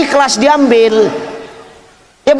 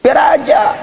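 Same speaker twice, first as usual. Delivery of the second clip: shouted. Shown as a demonstration. Repeats the advice, shouting.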